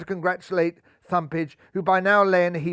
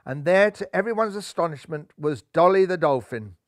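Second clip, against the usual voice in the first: plain reading voice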